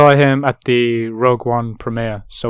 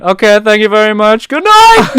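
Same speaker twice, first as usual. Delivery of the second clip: high, loud, shouted comedian goodbye; Seinfeld sign-off; 10 semitones higher, 12 dB louder than usual. Retold or imitated. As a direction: belt out the show's closing line like a hammed-up Seinfeld. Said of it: "And then he went".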